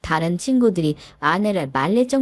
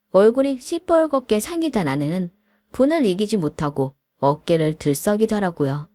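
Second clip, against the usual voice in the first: plain reading voice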